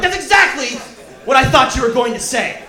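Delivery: high, loud retold protest; exasperated outburst. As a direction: burst out in comic outrage at the vet. Exasperated reply to the vet.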